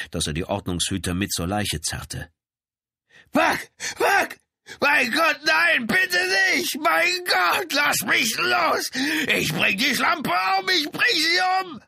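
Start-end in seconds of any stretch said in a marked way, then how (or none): 3.3-11.8 very high, loud screaming rage; frantic raging yells. A narrator's reading. Dead man's brother struggles against police, frantic screaming. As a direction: scream wildly, fighting to break free. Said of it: "Der Mann war außer Rand und Band".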